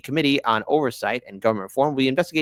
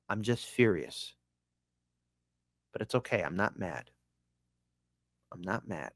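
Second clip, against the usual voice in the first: much softer, lower sarcastic denial; deadpan sarcasm; 5 semitones lower, 12 dB quieter than usual. Real, not acted. Vlogger insisting to viewers he's not mad, soft deadpan sarcasm. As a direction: mutter it like you're obviously fuming.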